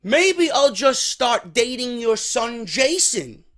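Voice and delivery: mock sweet and sarcastic, slow; drawn-out sarcastic taunt; lightly voiced snide offer. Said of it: "she sarcastically says".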